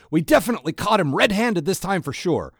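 high, loud and eager; excited. A narrator's reading. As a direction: keep up the giddy triumph. Terry continuing excitedly.

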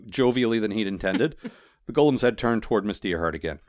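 ordinary delivery

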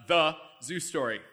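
usual speaking voice

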